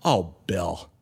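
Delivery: low, let-down sigh of a mentor; disgusted. Retold or imitated. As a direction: imitate a mentor's weary disgust. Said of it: "Just disgusted. He's disgusted at me".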